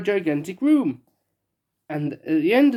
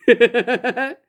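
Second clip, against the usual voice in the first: amused laugh; 7 semitones higher, 5 dB louder than usual. Real, not acted. Narrator laughs at her joke.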